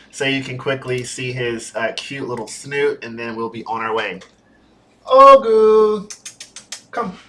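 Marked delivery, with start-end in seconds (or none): 5.1-7.2 high, loud call to the dog. Real, not acted. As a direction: call him over from across the room. Calling the dog over.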